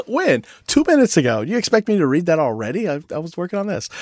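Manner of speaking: high-pitched mock-indignant objection, easing off toward the end; incredulous protest. Busy recipient answering someone who just emailed, mock-incredulous. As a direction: protest in exaggerated disbelief.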